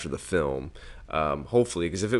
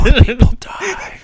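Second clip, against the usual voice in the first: laughing mock-ominous; 13 dB louder, slower than usual. Joining the whispered scare joke.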